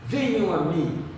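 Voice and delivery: ordinary delivery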